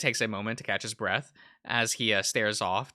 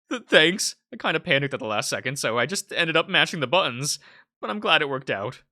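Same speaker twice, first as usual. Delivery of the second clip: flustered; 5 semitones higher, 5 dB louder than usual. Acted. Flustered after praise.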